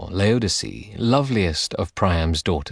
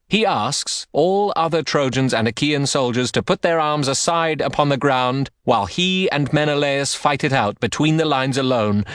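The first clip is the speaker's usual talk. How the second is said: higher, ringing herald's address; Hector's proclamation; 7 semitones higher than usual. Acted. Hector addresses both armies, higher and ringing.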